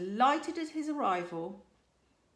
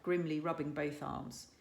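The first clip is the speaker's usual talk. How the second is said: ordinary delivery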